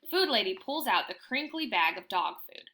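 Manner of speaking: plain reading voice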